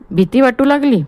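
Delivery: high, cutting question after stopping the laugh; curt, abrupt. Acted. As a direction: cut the laughter off and snap it short. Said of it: "एकाएकी हसणे थांबवून तुटकपणे". (तुटकपणे, अचानक)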